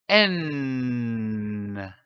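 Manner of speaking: a long hummed "N" held through the nose, pitch gliding down; sustained N sound. Shown as a demonstration. Teacher showing pupils the sound N, long humming "N". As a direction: hold "N" as a steady nasal hum for the class. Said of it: "pour sentir la résonance du son".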